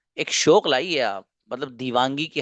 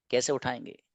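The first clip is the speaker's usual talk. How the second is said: dropped much softer, an echo to themselves; soft repeat; 7 dB quieter than usual. Real, not acted. Teacher softly repeating the question to his students. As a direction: let the question sink in quietly.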